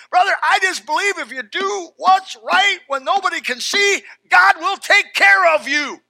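loud, high-pitched exhortation; full-force preaching. Real, not acted. Pastor exhorting the brothers to do right, full-force preaching. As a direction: proclaim it loudly to the whole church.

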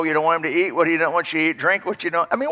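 normal talking voice

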